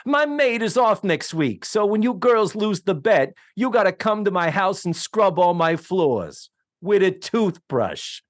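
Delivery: hammed-up snob bragging about her maid; snooty Rosie. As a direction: lord it over them from up high. Rosie voiced, snobbish.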